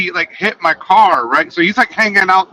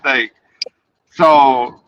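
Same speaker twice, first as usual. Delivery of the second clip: usual speaking voice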